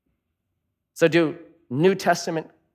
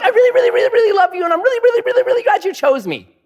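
mock gushing groom; much faster than usual. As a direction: parody a lovesick guy tripping over his reallys. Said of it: "Or the dudes are like".

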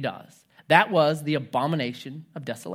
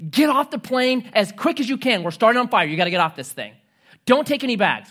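higher, louder mock-frantic evacuation orders; urgent stewardess; 6 semitones higher, 5 dB louder than usual. Stewardess urging passengers off the burning plane, higher and louder. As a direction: play a stewardess hurrying passengers off. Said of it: "the stewardess was like".

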